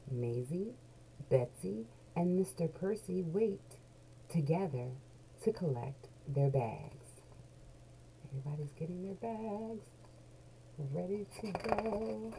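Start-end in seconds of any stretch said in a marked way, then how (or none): none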